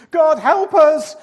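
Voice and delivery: terrified cry. A narrator's reading. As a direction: scream for help as the ship goes down. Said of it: "cried a sailor".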